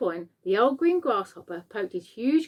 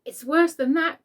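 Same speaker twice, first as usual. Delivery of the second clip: much higher, louder alarmed cry; cried out; 8 semitones higher, 5 dB louder than usual. A narrator's reading. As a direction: outdo him in panic. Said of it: "cried the chief of police".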